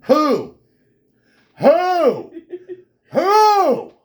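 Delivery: very high, loud rising shouts with a laugh; mock baffled shouts. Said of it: "all she sees is this".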